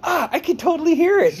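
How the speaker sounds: high, loud imitation of a friend; mock-excited exclamation. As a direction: squeal the discovery, hammed up. Acts out friend's gleeful discovery.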